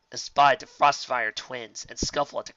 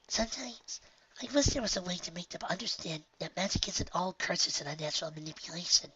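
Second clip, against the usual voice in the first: hushed, high and wistful; wishful young filly, quietly; soft high filly voice; 6 semitones higher, 7 dB quieter than usual. Acted. Honeydew voice.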